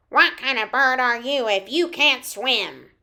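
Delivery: loud duck-voiced comeback; quacking duck. A narrator's reading. Duck answers the teasing bird, loud quacky retort. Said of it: "The duck quacked back".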